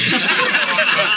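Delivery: a quick high hum of laughter; a brief amused chuckle; short laugh. Real, not acted. Host chuckling at the conspiracy book, light laugh.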